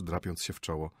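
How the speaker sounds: ordinary delivery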